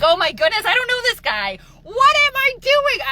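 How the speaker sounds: hammed-up disbelief at yourself; mock panic. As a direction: act out your inner freak-out, climbing to a loud high question. Retold inner panic at own behavior.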